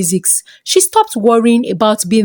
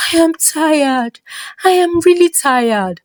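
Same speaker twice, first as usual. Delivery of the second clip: breaking down in tears; 6 semitones higher than usual. Acted. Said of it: "Ijeoma broke down".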